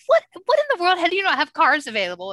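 high, loud what in the world; incredulous outburst. Retold or imitated. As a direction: blurt out stunned disbelief. Disbelief at no rentals.

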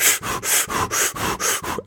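fast, heavy breaths in and out, unvoiced; imitated panicked breathing. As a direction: act out her panic attack breathing. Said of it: "I don't know if you can hear that on the microphone".

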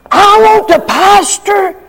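ordinary talk

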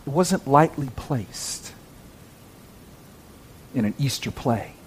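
hushed and deliberate, with long pauses; intense hush. Real, not acted. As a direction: drop to a grave whisper on the cruelty. Dramatic hush after describing the thorns.

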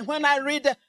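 ordinary talk, nothing marked

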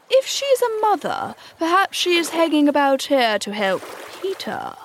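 high-pitched, suspicious pirate voice. A narrator's reading. Suspicious Starkey warns about the mother bird, high and wary. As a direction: voice a suspicious crewman, pitched well up. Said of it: "the more suspicious Starkey said".

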